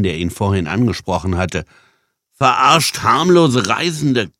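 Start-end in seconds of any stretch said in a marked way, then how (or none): 2.3-4.3 exhausted panting. A narrator's reading. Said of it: "keuchte Jan erschöpft".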